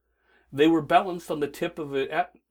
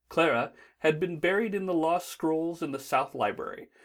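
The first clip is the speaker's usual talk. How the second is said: usual speaking voice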